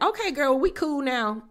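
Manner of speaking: high, sing-song mock sweetness; high mocking girlfriend voice; mock friendly. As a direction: mimic a fake make-up. Mocking fake reconciliation.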